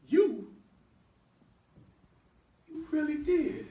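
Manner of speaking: loud, high stunned "You?"; shocked disbelief. Acted. Brother reacting loud and stunned to the drug confession. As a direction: hit him with shocked outrage.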